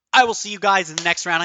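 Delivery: loud, fast, excited "I will see you guys in the next round"; hyped sign-off. Real, not acted. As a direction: rush the goodbye full of energy. Hyped sign-off after win.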